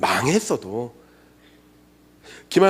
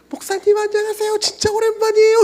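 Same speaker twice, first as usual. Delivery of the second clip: put-on young woman's greeting; 5 dB louder than usual. Former student greets pastor.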